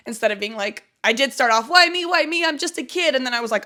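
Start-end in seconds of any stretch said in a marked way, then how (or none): 1.7-3.1 high-pitched "poor me" voice; mock childish self-pity; self-pitying kid. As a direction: imitate a child's high complaint. Acting her self-pitying childhood self.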